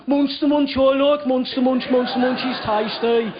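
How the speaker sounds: hammed-up Birmingham impression; Birmingham accent. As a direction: imitate a Birmingham flatmate, broad vowels. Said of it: "in his Birmingham accent".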